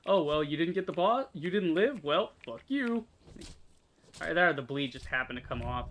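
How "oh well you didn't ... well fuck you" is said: mocking sarcastic voice. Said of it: "it's like".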